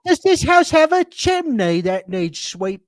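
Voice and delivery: high, loud mock cockney; cockney chimney sweep. Cohost jokes as a chimney sweep, loud high mock cockney. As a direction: ham up a Mary Poppins chimney sweep.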